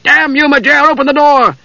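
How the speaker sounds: high furious yelling; furious shout. A narrator's reading. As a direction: roar at the locked door. Knight raging at shut door.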